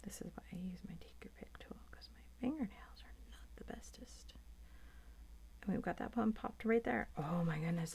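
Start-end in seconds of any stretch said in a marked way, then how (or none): 0.0-4.3 quick confiding whisper; fast whispered aside about fingernails. Real, not acted.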